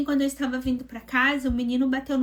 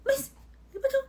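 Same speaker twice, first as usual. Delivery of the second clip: whimpering protest; 12 semitones higher, faster than usual. Retold or imitated. Protesting to God, near tears.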